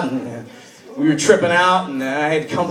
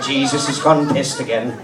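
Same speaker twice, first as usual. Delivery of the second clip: put-on Irish accent; 6 semitones lower, 4 dB quieter than usual. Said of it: "Poor Irish mother".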